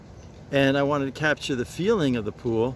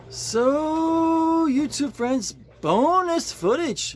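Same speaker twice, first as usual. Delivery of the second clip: excited announcement; 13 semitones higher, 4 dB louder, much slower than usual. Real, not acted. Announcing bonus footage to viewers.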